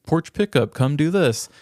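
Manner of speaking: normal talking voice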